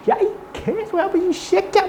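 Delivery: high, shouted insult in a put-on voice; playing the furious one, voice jumping high; mock angry yell. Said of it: "막 이러는데". (흉내 낸 화난 고함)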